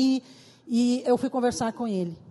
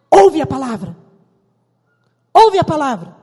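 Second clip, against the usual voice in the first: louder, emphatic pulpit delivery; fervent preaching; 4 semitones higher, 10 dB louder than usual. Acted. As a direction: preach it out to the congregation. Preaching at full force. (pregação fervorosa e alta)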